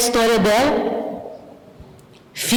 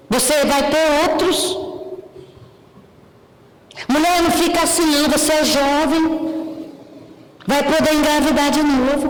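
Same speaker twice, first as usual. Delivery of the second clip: higher, brisk dismissive comforting; insensitive friend; 5 semitones higher than usual. Retold or imitated. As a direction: brush off her tears cheerfully. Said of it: "aí você chega lá e fala assim". (amiga sem tato, mais aguda e apressada)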